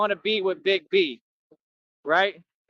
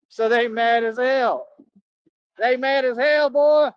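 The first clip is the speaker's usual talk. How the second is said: loud, higher gloating taunt; building taunt at the haters, louder and higher; mocking taunt; 5 semitones higher, 5 dB louder than usual. Real, not acted. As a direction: rub it in loudly, grinning.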